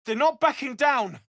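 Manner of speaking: high, strained shout of warning; urgent battle cry. Acted. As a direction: call out the danger over a fight. Calling out warning in a fight.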